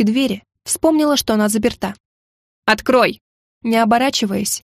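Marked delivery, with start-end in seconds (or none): 2.6-3.2 demanding. A narrator's reading. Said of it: "довольно требовательно произнесла я".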